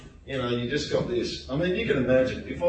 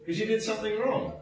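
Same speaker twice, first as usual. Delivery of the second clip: mildly exasperated retort, higher pitched; retold exasperation; 8 semitones higher than usual. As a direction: push back in disbelief at the student. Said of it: "I was going".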